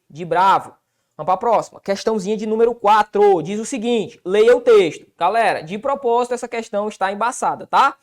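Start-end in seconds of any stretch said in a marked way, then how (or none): none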